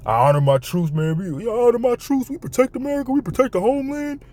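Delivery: high, hammed-up mock patriot voice; mock soldier voice. Streamer mocking soldiers in a high put-on voice. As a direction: parody the troops in a squeaky put-on voice.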